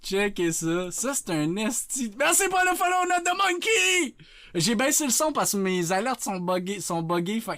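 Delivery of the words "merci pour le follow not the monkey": high, held-up excited shout-out; hyped thanks. Acted. Streamer thanking a new follower, high and hyped.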